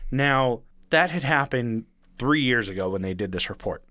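ordinary talk

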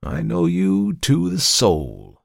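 slow, high, solemnly gentle; kind severity. A narrator's reading. Visitor claims to know Markheim's soul, with kind severity. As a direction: draw it out with kind gravity. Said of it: "a sort of kind severity rather than firmness".

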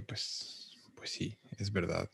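susurrado, casi sin voz, pensando para sí; susurro. Retold or imitated. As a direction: en un susurro, dándole la razón. Se convence a sí mismo. (susurro pensativo)

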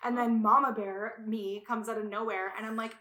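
ordinary talk, nothing marked

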